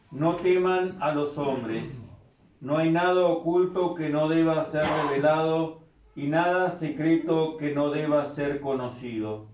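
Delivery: usual speaking voice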